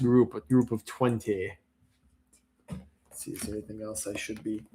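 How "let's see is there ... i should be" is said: muttering. Real, not acted. Muttering to himself while sorting.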